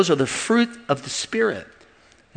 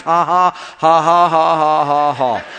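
made-up laugh when near tears; forced laugh; 5 dB louder, much slower than usual. Shown as a demonstration. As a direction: fake laughing by faith. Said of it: "Just by faith sometimes".

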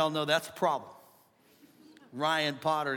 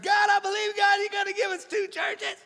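hammed-up pleading prayer. Pastor acting out praying for overflow, hammed-up pleading. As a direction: beg heaven at full pitch, mock-fervent.

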